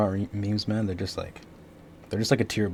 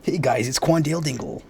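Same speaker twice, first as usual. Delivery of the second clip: higher, hammed-up announcer greeting; radio announcer voice; 6 semitones higher than usual. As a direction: mimic a cheesy radio announcer.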